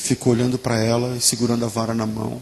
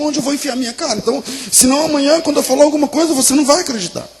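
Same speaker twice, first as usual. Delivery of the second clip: urgent insistence; 8 semitones higher, 4 dB louder than usual. Acted. Mother orders her son to beat her instead, urgently insistent. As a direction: drive the order home, ending on a loud command.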